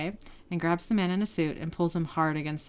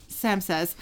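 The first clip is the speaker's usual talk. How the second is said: usual speaking voice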